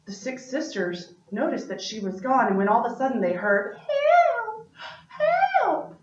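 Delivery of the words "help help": put-on very high little baby calling out; baby sister calling for rescue from the dragon's cave; baby crying help. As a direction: squeak it out like a tiny baby in trouble.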